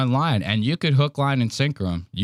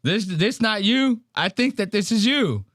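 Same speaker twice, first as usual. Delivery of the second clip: mocking taunt; 8 semitones higher than usual. Mocking taunt quoted to Zach.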